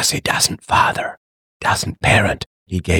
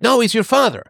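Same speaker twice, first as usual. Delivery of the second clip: pitched-up retort; high mock voice; 13 semitones higher than usual. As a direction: quote the other voice, higher. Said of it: "he's like".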